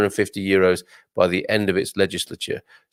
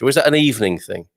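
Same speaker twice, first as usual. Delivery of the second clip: ordinary talk, nothing marked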